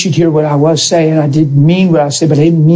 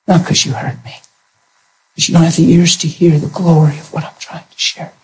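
whispered retort. Retold or imitated. Husband whispering a pointed retort to his wife. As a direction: hiss the reproach under your breath.

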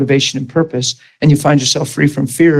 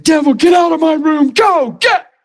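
commanding shout; 14 semitones higher than usual. Retold or imitated. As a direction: drive the devil out with a shout. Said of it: "proclaiming my salvation".

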